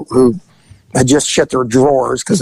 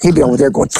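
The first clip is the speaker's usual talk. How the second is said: ordinary delivery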